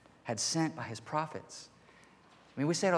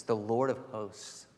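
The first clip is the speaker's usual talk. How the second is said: "the Lord of hosts" said slowly and deliberately; emphatic; slower than usual. Real, not acted. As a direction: give "the Lord of hosts" slow, solemn weight. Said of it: "I wanna say this with emphasis".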